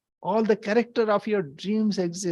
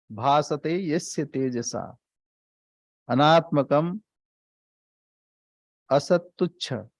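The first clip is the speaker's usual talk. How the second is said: scripture recitation; 2 semitones lower than usual. Acted. Recites next Sanskrit verse.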